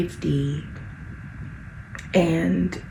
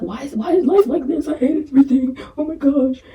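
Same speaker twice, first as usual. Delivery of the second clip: mock dramatic whine; 6 semitones higher, 4 dB louder than usual. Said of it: "and then I'll be like".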